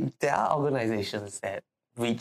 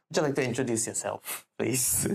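ordinary talk, nothing marked

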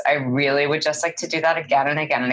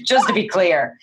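ordinary talk, nothing marked

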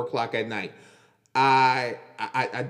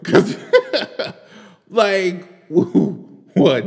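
laughing; 6 semitones higher, 9 dB louder, much slower than usual. Real, not acted. Laughing at the quote.

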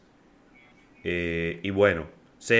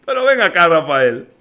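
high, loud, laughing disbelief; cracking up while protesting loudly; 5 semitones higher, 6 dB louder than usual. Real, not acted. Mocking the airline miles, laughing.

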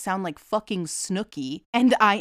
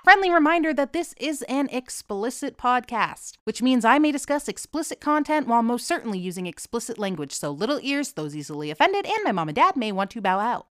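rapid-fire podcast disclaimer; rattled off at speed; wicked fast; 4 semitones higher than usual. Real, not acted. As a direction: race through it like required small print. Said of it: "I tried to do that wicked fast".

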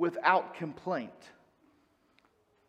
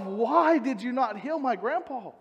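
a mourner questioning God, gently raised; grieving question; 5 semitones higher than usual. Retold or imitated. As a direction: ask why, hurting from the loss. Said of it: "I began asking myself".

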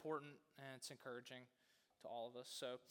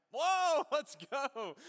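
loud, high burst of excitement with a laugh; excited cheer; 11 semitones higher, 14 dB louder, much slower than usual. Retold or imitated. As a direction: whoop it out, amazed and delighted. Retold excited reaction to giving.

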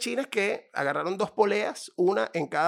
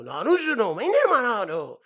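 louder, higher mock protest; hammed-up argument; mock shopkeeper; 6 semitones higher, 6 dB louder, slower than usual. Shopkeeper protesting to his wife, louder mock argument. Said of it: "empieza el tipo".

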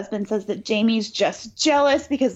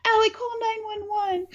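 high, wailing mock panic; Lisa; 10 semitones higher than usual. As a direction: cry out for help in hammed-up hysterics. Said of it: "Lisa just starts screaming".

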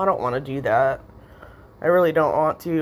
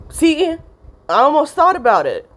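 startled catch; 10 semitones higher, 6 dB louder than usual. Real, not acted. Catches herself mid-rant.

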